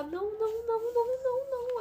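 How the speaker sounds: voz aguda e alta, negando em pânico; desespero chorado. Retold or imitated. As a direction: imita a cunhada gritando não em choque. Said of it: "ela só sabia falar". (cunhada em desespero ao saber da morte, chorando alto)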